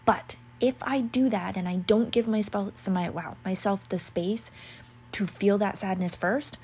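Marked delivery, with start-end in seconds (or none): none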